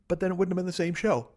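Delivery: ordinary talk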